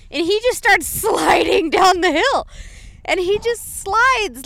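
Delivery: high, loud, stretched-out excitement; animated storytelling. Retold or imitated. Telling how the horse slid downhill, animated and high. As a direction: let the pitch soar and drag out the slide. Climax of horse's fall retold.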